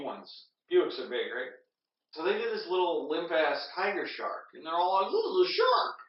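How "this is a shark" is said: very high-pitched mock announcement; mock excitement. Townsfolk present their catch, high mock excitement. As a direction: pipe it up high, imitating excitement. Retold crowd showing off catch.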